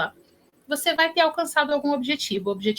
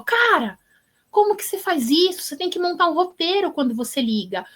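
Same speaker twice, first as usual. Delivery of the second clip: high-pitched incredulous telling-off, acted out; mock indignant reproach; exasperated scolding; 7 semitones higher than usual. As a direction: scold a friend like a bossy kid. Acts out classmate scolding shy caller.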